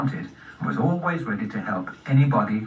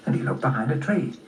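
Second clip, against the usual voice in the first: ordinary delivery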